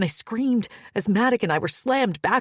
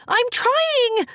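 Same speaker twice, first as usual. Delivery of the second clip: high, loud strained yell; yelled back; 6 dB louder than usual. A narrator's reading. Fleck yelling back at Maddock while clinging. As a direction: shout back while slipping. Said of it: "I yelled back".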